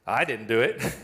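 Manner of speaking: put-on low, guilty little-boy denial; a lightly played guilty child blurting it out; sheepish denial. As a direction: blurt it like a caught kid. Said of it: "the words just come out".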